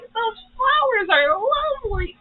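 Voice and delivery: imitating a tearful wail; crying.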